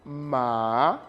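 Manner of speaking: "ma" starting low and sweeping up; rising second tone. Shown as a demonstration. Teacher showing M with A, "ma" in rising second tone. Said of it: "Le deuxième ton".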